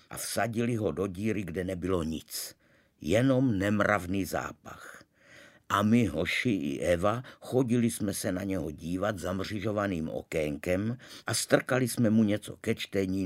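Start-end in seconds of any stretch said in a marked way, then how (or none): none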